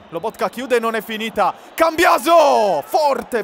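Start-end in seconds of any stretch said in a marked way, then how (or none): 1.7-3.4 high, loud name call, slowing; rising surge as the shot goes; excited shout. Real, not acted. Shot on goal builds.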